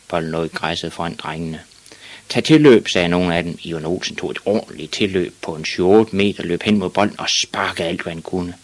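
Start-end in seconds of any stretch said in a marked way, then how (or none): 2.3-2.9 high, loud schoolboy instruction; calling out. A narrator's reading. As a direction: call the order across the pitch. Boys call out instructions.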